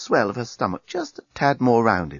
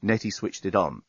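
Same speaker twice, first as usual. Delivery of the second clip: usual speaking voice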